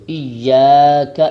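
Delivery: ایاک said slowly and loudly with a long alif; emphatic teaching example; stressed example. Shown as a demonstration. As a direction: call out ایاک with the alif stretched. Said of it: "ایک الف کی مقدار لمبا کریں گے".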